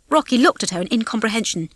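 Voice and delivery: ordinary delivery, nothing marked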